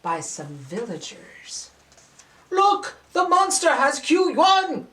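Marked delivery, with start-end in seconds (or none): 2.5-4.9 high, loud alarmed cry; villager crying out. Acted. Fisherman at the water's edge cries out in alarm. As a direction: shout the alarm from the shore. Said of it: "cried out one of the many fisher folk".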